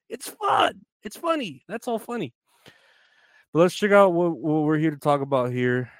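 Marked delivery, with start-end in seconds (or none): 0.1-0.8 very high excited shout. Pretend. Repeating show slogan, shouted. 1.0-2.3 high playful voice. Pretend. Show title delivered high.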